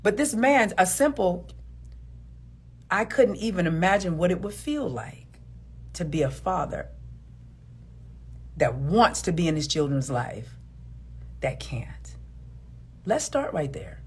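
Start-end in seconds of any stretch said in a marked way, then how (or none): 2.9-12.2 softer, lower, fading to a whisper; tender reassurance. Shown as a demonstration. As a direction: speak gently like you understand him. Model of what she should say.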